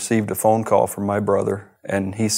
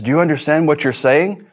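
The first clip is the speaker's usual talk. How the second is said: retold agent's alarm, higher and louder; shocked agent; 6 semitones higher, 5 dB louder than usual. Agent hearing him admit involvement, alarmed and higher. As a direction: jump up in pitch, shocked.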